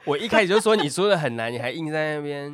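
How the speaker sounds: loud, accusing, half laughing; loud teasing complaint. Real, not acted. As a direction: call her out loudly.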